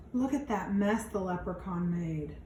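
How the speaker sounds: plain reading voice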